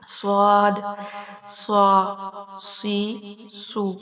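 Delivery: صاد صا سی سو said thick and heavy; heavy saad drill. Shown as a demonstration. Teacher drilling the heavy ص with each vowel. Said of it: "صاد کو موٹا".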